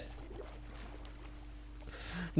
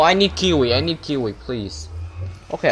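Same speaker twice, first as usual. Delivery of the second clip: ordinary delivery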